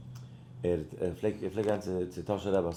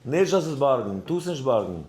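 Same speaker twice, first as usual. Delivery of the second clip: raised, higher voice; emphatic retold refusal; 5 semitones higher, 4 dB louder than usual. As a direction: firmly act out the Rebbe's ruling. Rebbe's refusal repeated forcefully.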